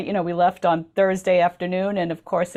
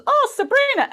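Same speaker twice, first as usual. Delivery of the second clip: a much higher, cheery mock call of recognition; delighted greeting; 4 dB louder than usual. Bus driver greeting Sabrina at the light, high cheery call. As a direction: hail her like an old friend spotted from the bus. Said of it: "he looks down and goes".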